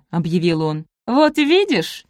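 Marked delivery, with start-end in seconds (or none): 1.1-2.1 high, drawn-out triumphant cry. A narrator's reading. Элоиза proves her sister wrong about the visitor, triumphant. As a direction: crow it slowly, pitch lifted. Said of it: "торжествующе воскликнула Элоиза".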